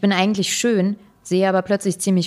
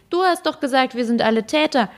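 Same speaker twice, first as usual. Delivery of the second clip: mild mock call; 6 semitones higher than usual.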